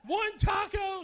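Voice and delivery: hammed-up outrage over one taco; retelling his shock at the taco line, mock outrage; outraged disbelief. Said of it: "I was like".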